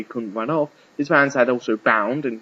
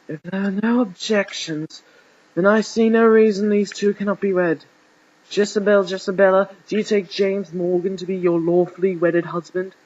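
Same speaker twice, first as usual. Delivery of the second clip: higher, ceremonious officiant voice; archbishop officiating; 7 semitones higher than usual. A narrator's reading. Archbishop asking the bride for her vow, ceremonious. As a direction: intone it formally, pitched up.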